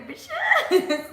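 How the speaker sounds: high, loud cackling jab; squealed laughing insult; laughing taunt. Real, not acted. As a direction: toss it off laughing and cheeky. Cheeky taunt at viewers.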